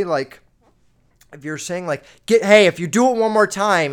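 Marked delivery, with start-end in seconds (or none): 2.3-3.9 put-on loud, high yelling threat at a kid; mock teacher yell. Teacher yelling a warning at laughing kids, loud. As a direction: yell the warning with a pointing finger. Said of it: "you're yelling at 'em".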